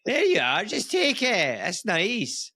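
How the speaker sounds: high, generous Scottish merchant; Scottish accent. Acted. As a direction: ham up a high-pitched Scots shopkeeper. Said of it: "do you do a Scottish accent".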